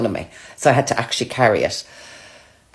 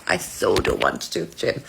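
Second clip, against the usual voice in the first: much lower put-on moaning voice; low whiny groan; 10 semitones lower, 4 dB quieter than usual.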